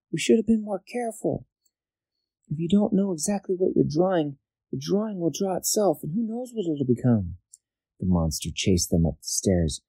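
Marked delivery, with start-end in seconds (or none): 0.1-7.4 high, earnest princess voice; cartoon princess. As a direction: warn them like a cartoon girl. Princess character voice.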